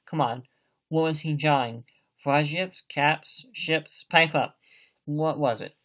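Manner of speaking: loud, impatient demand; bully the answer out of him; loud badgering. Silver badgering Morgan.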